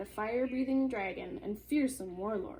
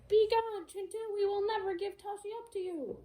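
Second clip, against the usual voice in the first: high, loud defiant cry; brave father crying out; 11 semitones higher, 4 dB louder than usual. Acted. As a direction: shout defiance at the giant. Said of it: "ran out into the street and cried".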